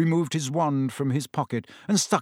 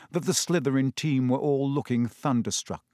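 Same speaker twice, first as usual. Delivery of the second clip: ordinary delivery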